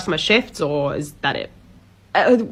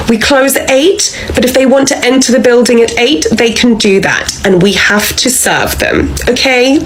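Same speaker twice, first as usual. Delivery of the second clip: scolding; 4 semitones higher, 6 dB louder than usual. Acted. Manager scolding employee at closing.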